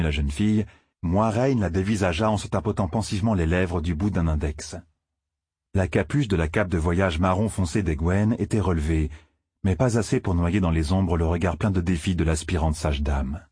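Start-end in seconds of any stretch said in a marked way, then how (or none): none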